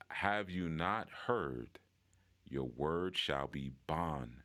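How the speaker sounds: slow, deliberate recital with pauses; half-speed, measured delivery of a memorized lesson. Shown as a demonstration. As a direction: recite it slowly, phrase by phrase. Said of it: "Actually, I'll say it slower too".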